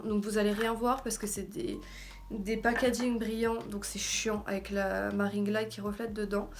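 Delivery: ordinary talk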